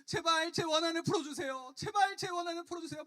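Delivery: very high, loud wailing plea; desperate pleading. Acted. Widow coming back day after day, desperately begging. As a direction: beg insistently at the top of your range. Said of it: "막 이러면서 하루가 멀다 하고 계속 찾아왔던".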